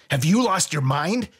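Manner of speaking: high-pitched outraged question; fierce. A narrator's reading. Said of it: "Sai's expression became fierce".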